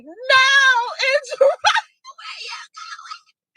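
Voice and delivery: high, slow mock wailing cry; hammed-up high wail. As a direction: wail it like a dramatic goodbye. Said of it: "I was like".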